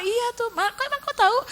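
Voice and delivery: very high-pitched surprised reply; put-on high conversational voice; acted chatty reply. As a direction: play up the puzzled answer to a neighbour. Acts out her answer to neighbour.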